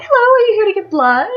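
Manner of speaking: high, loud imitation of a welcoming worker; chirpy staff greeting. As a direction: mimic an over-friendly greeter.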